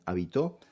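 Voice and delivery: "habitó" stressed on the final tó; stress on last syllable. Shown as a demonstration. Teacher defining aguda words, "habitó" stressed on tó. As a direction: put the weight at the end, "habitó" as ha-bi-TÓ. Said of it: "Si se acentúa la última sílaba".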